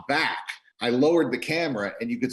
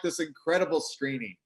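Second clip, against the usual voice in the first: ordinary talk, nothing marked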